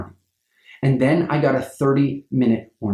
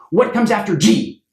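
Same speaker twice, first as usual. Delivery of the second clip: calling out a question; 6 semitones higher than usual. Shown as a demonstration. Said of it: "just immediately say".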